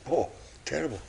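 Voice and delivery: soft, gasping mock horror; gasp, then say it faintly, aghast; shaken dismay. Old lady sits down shaking after the coffin, faint dismay.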